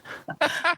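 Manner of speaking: quick high-pitched chuckle; a brief bright laugh; short high laugh. Real, not acted. Laughs at own question.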